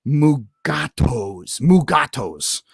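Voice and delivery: "Mugatos" drawn out very slowly, syllable by syllable; slow, deliberate "Mugatos" with long vowels; imitated odd pronunciation. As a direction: imitate his "Mugatos", stretching each syllable. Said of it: "pronouncing it a little bit different".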